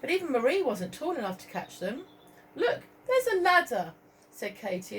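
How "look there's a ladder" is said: put-on excited girl. Katie voiced excitedly.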